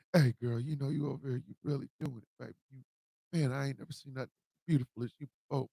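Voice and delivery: mock smooth pickup line, hushed and quick; smooth talker voice for a girl; smooth preacher man voice. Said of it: "smooth, deep, uh, preacher man voice".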